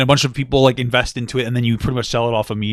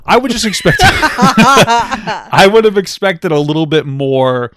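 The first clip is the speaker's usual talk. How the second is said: louder, slow, laughing through the words; louder retake; 7 semitones higher, 6 dB louder, slower than usual. Shown as a demonstration. Redoing the line louder for the mic, laughing. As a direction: belt it out again, cracking up. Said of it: "That was quiet".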